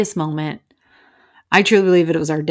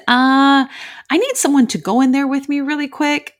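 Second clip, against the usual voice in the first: uneasy request; 8 semitones higher, 3 dB louder than usual. Retold or imitated. Acting out nervous request to officers.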